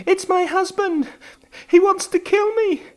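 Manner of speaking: high anxious woman's voice; frightened woman. A narrator's reading. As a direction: plead with the detective for your life. Client voiced in a story.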